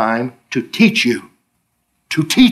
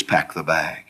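much lower and softer "Pack the bag."; low quiet command; 12 semitones lower, 9 dB quieter, slower than usual. Retold or imitated. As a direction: speak it low and simple.

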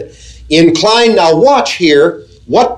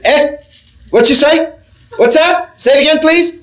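much higher, strained "Eh? What you say?"; hard-of-hearing person; 10 semitones higher than usual. Retold or imitated. Preacher mimics someone cupping an ear, asking for a repeat. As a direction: pitch up like someone straining to catch words. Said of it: "They grab the ear like this".

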